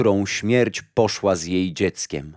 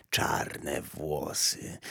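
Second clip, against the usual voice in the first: put-on hushed, slow breathy old woman; secretive whisper; 6 semitones lower, 11 dB quieter, much slower than usual. Old woman asks for hair.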